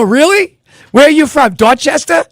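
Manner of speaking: high, loud mock Boston accent. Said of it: "the Boston accent is the most ignorant accent".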